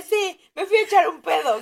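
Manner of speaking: high and loud, defiant; fed-up retort. Retold or imitated. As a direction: throw it right back at him. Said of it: "estaba tan harta que dije".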